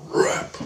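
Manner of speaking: voiceless tearing sound effect; imitated ripping noise. Storyteller showing a skin torn off, rasped ripping effect. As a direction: make the word into the sound of tearing.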